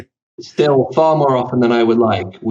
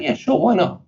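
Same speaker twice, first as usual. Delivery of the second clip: high-pitched, carefree retold yes; breezy agreement; 11 semitones higher than usual. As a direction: quote yourself agreeing lightly, pitched well up. Quoting own casual agreement to help.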